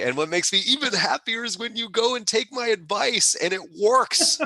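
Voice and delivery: beaming with real delight, laughing; thrilled giggle breaking into the words; excited laughing. Real, not acted. Gushing about mentees succeeding.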